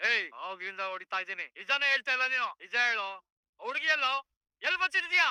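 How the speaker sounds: loud, high furious shouting; angry interrogation. Acted. Policeman demanding where the girl is hidden, shouting angrily. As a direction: shout demands, building to a peak.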